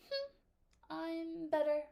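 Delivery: haughty, high-pitched "I'm better"; snooty. Shown as a demonstration. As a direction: sniff and look down on everyone. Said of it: "So like".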